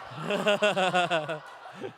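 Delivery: a real laugh that swells up; rising real laugh. Real, not acted. Preacher cracking up at his own story, real laughter. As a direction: let the laugh run.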